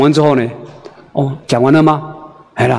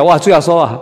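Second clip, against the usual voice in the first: ordinary talk, nothing marked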